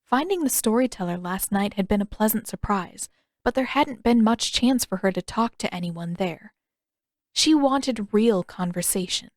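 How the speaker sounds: ordinary delivery, nothing marked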